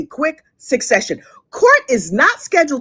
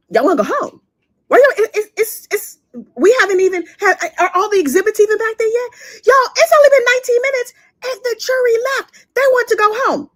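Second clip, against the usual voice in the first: incredulous; 9 semitones higher than usual. Real, not acted. Disbelief at quick verdict.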